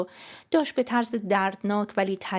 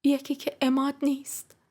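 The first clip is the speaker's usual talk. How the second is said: higher, level, hesitant confession; tense, pitched-up admission; forced-out confession; 6 semitones higher than usual. Acted. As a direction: get the words out with effort. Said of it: "لبای خشکیدم و به زور باز کردم".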